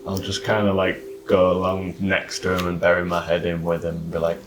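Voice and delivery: usual speaking voice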